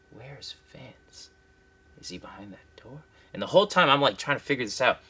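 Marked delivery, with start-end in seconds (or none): 0.1-3.0 hushed unvoiced whisper. Nervous newcomer whispering in the boss's hallway. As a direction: barely breathe the words.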